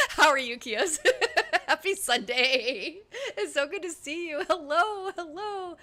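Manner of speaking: high-pitched hello through real laughter; laughing greeting. Real, not acted. Streamer greeting Kios on a Sunday, laughing. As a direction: greet them while cracking up.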